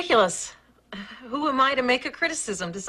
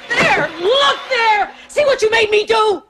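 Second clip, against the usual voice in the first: higher, loud, slow accusing shout; angry shout; 10 semitones higher, 7 dB louder than usual. Acted. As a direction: blame him hard for her outburst. She blames him for her outburst.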